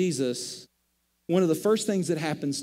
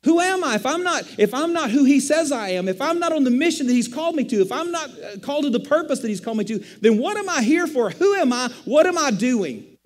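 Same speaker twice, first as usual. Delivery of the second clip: loud, high, desperate questioning; a pleading inner shout, louder and higher; anguished cry; 6 semitones higher, 4 dB louder than usual. Retold or imitated. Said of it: "I begin to ask the question".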